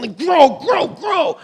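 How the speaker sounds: high, forceful mock yell; straining farmer. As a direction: yell it as if yanking on a tree. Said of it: "so then he starts to pull".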